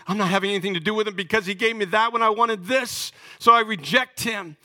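high, loud, indignant mock voice; defiant rejection. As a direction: spit out the rejection like a bitter believer. Voicing a bitter rejecter.

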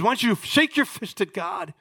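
high-pitched acted goading; angry wife. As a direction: voice a wife angrily urging her husband on. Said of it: "I think she's angry".

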